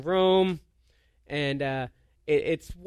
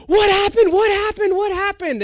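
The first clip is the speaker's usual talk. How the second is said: high, loud, frantic repeated cry; mock-panicked rapid-fire questioning at high pitch; alarmed; 13 semitones higher, 8 dB louder than usual. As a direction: imitate panicked family bursting in, voice shooting way up. Family rushing in alarmed, acted out.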